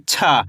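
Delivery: छा with aspirated ch, falling low; letter-sound demo. Shown as a demonstration. Teacher shows how to read it, letter-sound demo. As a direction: push air through a long छा. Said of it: "इसको कैसे पढ़ेंगे?".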